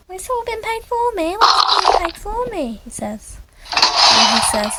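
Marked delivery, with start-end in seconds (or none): 0.1-2.8 squeaky surprise, stretching the words; amazed question. Acted. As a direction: ask it in a high voice, astonished. Character reacts to being treated.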